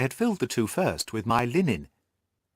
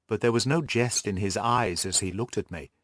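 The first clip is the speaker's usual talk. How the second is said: ordinary delivery, nothing marked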